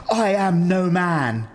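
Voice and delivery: slow, high, grand declaration; dramatic heroine. Pretend. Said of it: "and she goes".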